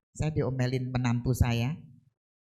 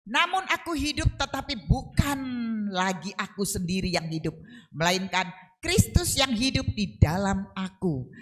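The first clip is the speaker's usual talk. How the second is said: high, forceful preaching of the verse; preached verse; 4 semitones higher than usual. Shown as a demonstration. Preacher re-reading the verse for the congregation, high and forceful. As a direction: proclaim it to the church. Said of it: "Ini teman-teman".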